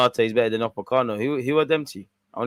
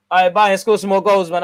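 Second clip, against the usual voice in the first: loud, higher call urging Bayern on; rallying shout; 5 semitones higher, 9 dB louder than usual. Real, not acted. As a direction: shout at the team on the screen. Yelling at the screen to Bayern.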